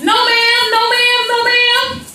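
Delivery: high, whiny mock pleading; repeated high-pitched childlike begging; child's pleading. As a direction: plead in a sing-song whine. Child pleads during whooping.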